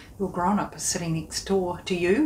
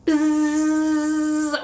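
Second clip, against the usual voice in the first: high loud bee buzzing; 5 semitones higher, 3 dB louder than usual. Acted. Presenter buzzing like bees flying out. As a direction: buzz like a bee.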